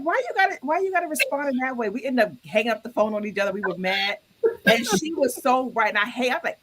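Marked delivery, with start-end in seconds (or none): 0.0-1.9 high-pitched, quickening complaint; mock-upset demand for an explanation; retold indignant protest. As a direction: replay an argument, pitched well up. Replays argument with friend.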